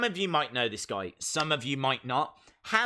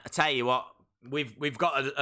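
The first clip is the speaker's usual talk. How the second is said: ordinary talk, nothing marked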